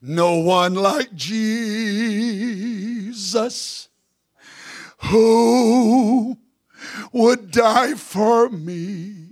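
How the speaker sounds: slow, raspy sung close; hoarse preacher, drawn out slowly. Real, not acted. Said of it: "with my raspy voice to say it like this".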